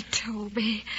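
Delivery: breathless whisper of his name. Acted. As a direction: barely voice it, pleading. Tender plea to Toby.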